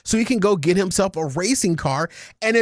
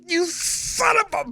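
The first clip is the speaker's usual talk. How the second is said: high, strained exasperated yell; exasperated cursing; 10 semitones higher than usual. Retold or imitated. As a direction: re-enact cursing at the screen, pitch way up. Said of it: "I'm sitting there just like".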